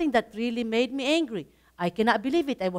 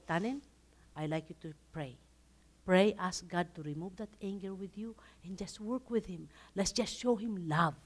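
soft gentle advice; 5 semitones lower, 11 dB quieter than usual. Retold or imitated. Advising angry coworker to pray.

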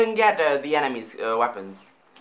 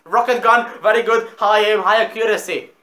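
excited; 6 semitones higher, 9 dB louder than usual. Real, not acted. Excited commentary on new gun.

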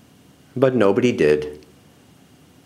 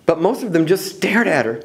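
ordinary delivery, nothing marked

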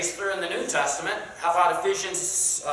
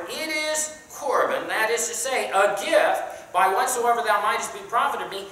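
higher, louder preaching read; King James read with preacher's lift; scripture reading; 5 semitones higher, 4 dB louder than usual. Acted. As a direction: declaim the verse to the class. Reads scripture aloud to class.

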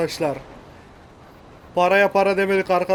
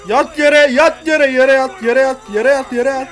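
higher, louder drawn-out commands; insistent repeated mock shouting; barked order; 3 semitones higher, 5 dB louder, slower than usual. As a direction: play a robber yelling at hostages. Acting robber ordering everyone down.

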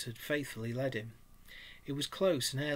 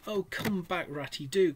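pleading cry; 5 semitones higher, 5 dB louder than usual. A narrator's reading. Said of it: "cried the Mole".

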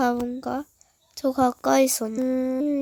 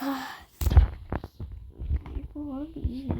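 ordinary talk

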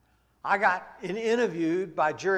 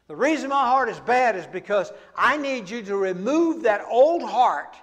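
loud, slowed climax of a sermon; preaching at full force; 3 semitones higher, 8 dB louder than usual. Acted. Preacher pleading for a new heart, at full force. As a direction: drive the point home to the congregation.

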